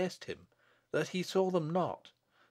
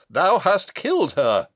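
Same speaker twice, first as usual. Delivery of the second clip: loud, raised outburst; accusing cry; 4 semitones higher, 10 dB louder than usual. Acted. Husband accuses the abbot as his wife falls, loud cry. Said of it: "he cried to the abbot".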